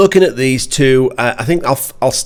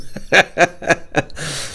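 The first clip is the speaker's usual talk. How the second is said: laughing; 5 semitones higher, 4 dB quieter than usual. Real, not acted. Laughing at own joke.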